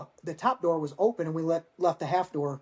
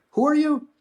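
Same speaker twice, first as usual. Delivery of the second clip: surprised; 11 semitones higher, 6 dB louder than usual. Retold or imitated. Disbelief at Delta guy's offer.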